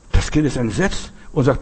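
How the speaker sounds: ordinary delivery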